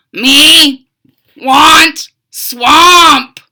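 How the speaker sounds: booming frog monster demand. Acted. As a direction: whine like a dumb monster.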